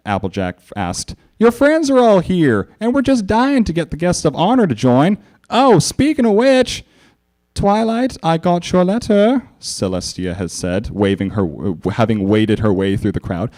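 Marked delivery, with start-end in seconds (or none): 1.4-9.4 pony calling. A narrator's reading. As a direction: call out warmly. Applejack then Celestia.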